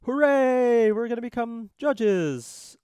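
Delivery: high, drawn-out hammed-up hooray; mock jubilant cheer. Judges celebrating their commissions, in a hammed-up cheer.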